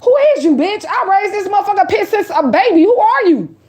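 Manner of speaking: high, indignant mock demand; imitating a stunned owner snapping back; high-pitched who-are-you attitude; offended dog owner. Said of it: "she looking back at me like".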